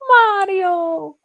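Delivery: high, loud, drawn-out Italian "MAH-dio"; imitating Mario's catchphrase. Streamer mimicking how he says it, high mock-Italian shout. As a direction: belt out a hammed-up cartoon Italian name. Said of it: "He says it like".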